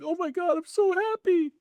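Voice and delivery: imitating a tearful client's high, joyful squeal; mock overjoyed cry of relief. Crying client's overjoyed reaction.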